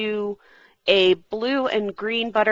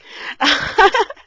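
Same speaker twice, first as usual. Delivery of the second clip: high laugh; 13 semitones higher, 7 dB louder than usual. Real, not acted. Laughs about the discontinued press.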